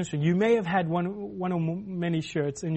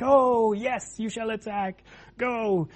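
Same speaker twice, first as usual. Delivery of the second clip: higher-pitched, eager urging; eager cheering prophets; 5 semitones higher, slower than usual. Acted. Prophets telling the king to attack his enemies, eager. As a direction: egg the king on with mock zeal.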